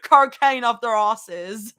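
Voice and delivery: slow, emphatic Australian attempt; Australian accent. Shown as a demonstration. Streamer showing off the accent, drawn-out Australian. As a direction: stretch the vowels in mock Australian. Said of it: "cocaine sounds very Australian".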